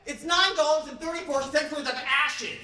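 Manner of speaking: high, loud, fussy wail; loud exasperated outburst. Acted. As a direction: cry out over ruined dinner. Felix upset over burnt roast.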